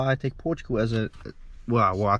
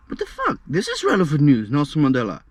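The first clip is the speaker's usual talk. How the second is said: indignant protest; 5 dB louder than usual. Retold or imitated. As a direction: push back like it's obvious. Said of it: "I said".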